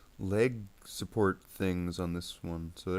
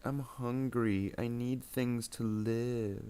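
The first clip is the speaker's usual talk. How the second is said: slow, drawn-out higher mock whine; mock hungry quote; 5 semitones higher, much slower than usual. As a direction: stretch it out, mimicking a hungry guy. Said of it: "He's all like".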